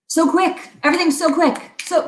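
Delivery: high-pitched mock frantic hurry; frantic rush. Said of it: "because we're like".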